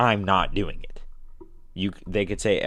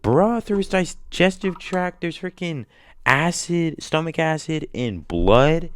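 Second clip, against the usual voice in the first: higher, agitated complaining; rant, pitched up and indignant; arguing with Jigsaw, higher and worked up; outraged rant; 10 semitones higher, 3 dB louder than usual. Pretend.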